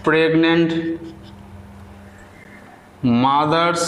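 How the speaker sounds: slow, word-by-word dictation with long pauses. Acted. Teacher dictating a paragraph to students, slow and deliberate. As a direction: read each word out clearly so students can write it down.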